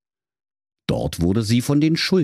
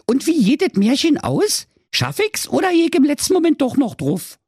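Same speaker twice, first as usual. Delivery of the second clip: put-on high, cheeky Berlin girl voice; little girl Ute; 3 dB louder than usual. Ute hands the moon rolls, high cheeky girl voice. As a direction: play the sassy little girl, pitched high.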